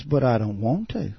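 lightly imitated tender pleading, rising in pitch; a mild mock plea for sympathy; retold little voice, gently coaxing; tender, deceiving voice. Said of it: "tender, compassionate voice, deceiving voice".

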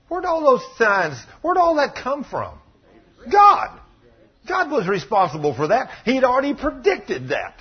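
high, emphatic question and ringing answer; impassioned preaching. Real, not acted. Preacher asks the congregation who caused it, impassioned. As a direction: preach it with rising force.

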